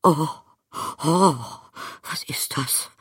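high, gasped shock; breathless startled gasps; gasping. A narrator's reading. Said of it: "keuchte sie".

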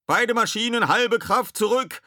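high, loud command; skipper's high-pitched roar; bellowed order. A narrator's reading. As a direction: shout orders down to the engine room. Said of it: "brüllte Ed".

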